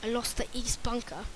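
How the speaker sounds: lightly put-on British accent. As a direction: slip into a playful British voice. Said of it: "I can do a British voice really well".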